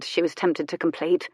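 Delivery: usual speaking voice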